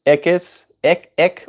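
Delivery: high-pitched Cape accent "Ik is". Shown as a demonstration. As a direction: repeat "ik" high with a tight K P accent vowel. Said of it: "in the K P accent".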